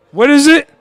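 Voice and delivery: ordinary delivery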